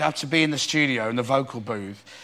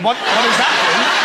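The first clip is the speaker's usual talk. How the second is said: laughing; 5 semitones higher, 6 dB louder than usual. Real, not acted. Laughing at odd warm-up.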